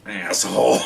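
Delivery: low, drawn-out bird imitation; talking bird. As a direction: mimic a bird's low retort, breaking into laughter. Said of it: "what he taught the bird".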